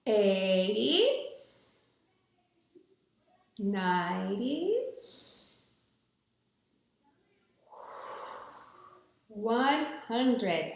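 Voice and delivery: long pauses between calm counts; slow breathing count. Real, not acted. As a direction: count slowly while breathing in. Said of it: "breathe in and we're gonna count".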